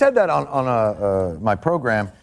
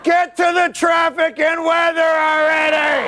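outraged, high-pitched comic holler; impatient viewer yelling. Acted. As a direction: yell at the TV news. Yells at local news clip.

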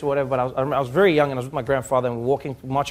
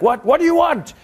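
much higher, loud impatient cop; irritated cop; 12 semitones higher, 5 dB louder than usual. Retold or imitated. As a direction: snap at the old man. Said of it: "the cop is like".

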